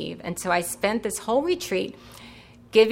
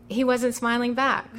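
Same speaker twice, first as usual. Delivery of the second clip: ordinary delivery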